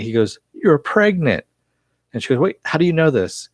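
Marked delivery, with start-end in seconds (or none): 0.5-1.4 sudden high-pitched realization; surprised. Retold or imitated. 2.4-3.5 high, startled question. Retold or imitated. Barbara startled by his guess.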